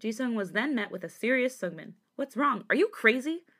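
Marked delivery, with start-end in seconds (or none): 2.2-3.4 higher-pitched, incredulous questions; sudden outburst. A narrator's reading. Serious cousin confronts Jisung, sudden outburst. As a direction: speak out suddenly, pitch jumping. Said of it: "Sungmin suddenly spoke out".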